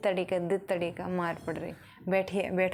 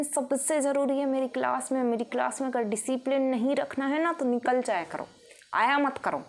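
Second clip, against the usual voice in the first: higher-pitched stern teacher scolding the class; strict teacher scolding; 6 semitones higher than usual. Retold or imitated. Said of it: "ma'am उसी seat पे उनको कह देती हैं".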